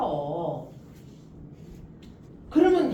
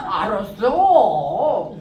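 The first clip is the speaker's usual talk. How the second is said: slow friend's reply; 9 dB louder, much slower than usual. Retold or imitated. Voices drunk friend agreeing.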